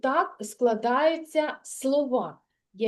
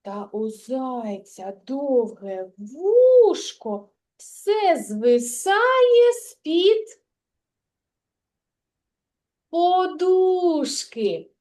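slow verse prompt; 2 semitones higher, 4 dB louder, much slower than usual. Shown as a demonstration. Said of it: "підкажи останні слова рядочки".

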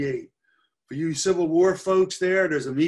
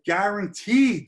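"guaranteed" punched out with raised pitch; stress on guaranteed; 5 semitones higher, 5 dB louder, slower than usual. Real, not acted. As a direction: lean hard on the word "guaranteed". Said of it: "I want to stress that word".

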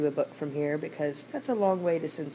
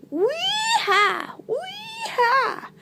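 very high, stretched monkey whoop; gleeful whooping; 3 dB louder, much slower than usual. Acted. Monkey swinging on his vine, high gleeful whoop.

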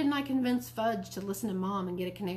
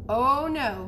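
loud, higher drawn-out objection; emphatic refusal; 5 semitones higher, 8 dB louder than usual. A narrator's reading. Mom refusing worms at breakfast, emphatic. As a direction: put your foot down, loudly.

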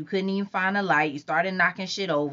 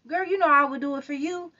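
higher, lightly voiced girlfriend; higher-voiced girl friend; girl's voice; 13 semitones higher than usual. A narrator's reading. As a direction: play the friend sweetly. Narrator voicing a girl friend.

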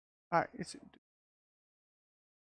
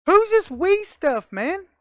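incredulous; 8 semitones higher, 6 dB louder, slower than usual. Real, not acted. Reacting to game oddity.

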